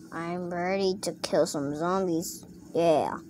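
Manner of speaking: soft, low pretend voice; quiet low figure. Toy ready to kill zombies, soft low voice. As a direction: say it low and hushed, in play.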